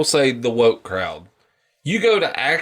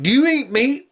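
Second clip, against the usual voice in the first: pointed question; 12 semitones higher than usual. Retold or imitated. Hunter challenged in retelling.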